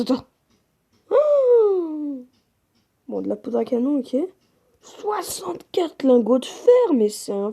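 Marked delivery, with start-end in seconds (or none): 1.1-2.2 high, loud amazed gasp; surprised. Real, not acted. Opening the gift chest. 4.8-6.9 high, slow, amazed listing; excited. Real, not acted. Listing the gift chest loot.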